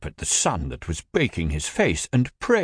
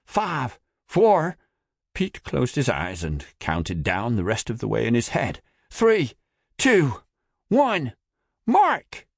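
calling out countdown; 5 semitones higher than usual. A narrator's reading. Countdown before the jump.

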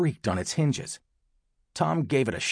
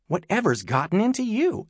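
higher-pitched, exasperated mom; annoyed; 10 semitones higher than usual. A narrator's reading. Mom confronting her son at the door, annoyed. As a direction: ask it with irritation, pitched up.

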